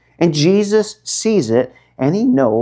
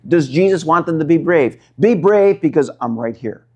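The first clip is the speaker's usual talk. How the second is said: ordinary talk, nothing marked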